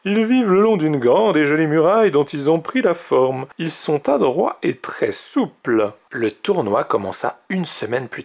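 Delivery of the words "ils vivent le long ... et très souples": high, animated storybook mage; animated teacherly dragon voice; old mage explaining. Acted. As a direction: explain it with relish, voice lifted.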